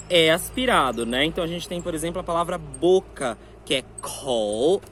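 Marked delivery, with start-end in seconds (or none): 3.6-4.8 kou lento com sopro de ar; aspirated kou. Shown as a demonstration. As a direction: sopre o kou devagar. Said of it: "vou mostrar aqui com o papel". (kou aspirado e lento)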